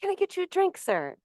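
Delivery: high-pitched service voice, dropping on the last word; lilting mock cabin-crew politeness; flight attendant. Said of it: "flight attendants will come up and say".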